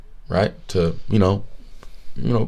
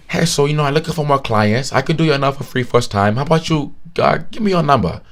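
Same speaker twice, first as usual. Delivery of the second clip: higher mock Vietnamese accent; 4 semitones higher than usual. Salon owner angling for his number, mock Vietnamese accent. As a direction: imitate a salesman-flirt with a Vietnamese accent. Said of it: "thick, thick Vietnamese accent".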